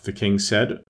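ordinary delivery, nothing marked

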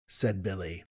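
plain reading voice

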